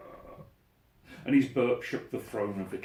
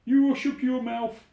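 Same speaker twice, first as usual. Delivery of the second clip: loud, raised roar of a tyrant king; King Yertle howling down at Mac, loud and raised; 12 semitones higher, 10 dB louder than usual. Acted. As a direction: bellow him down like a furious king. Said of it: "howled the mighty King Yertle".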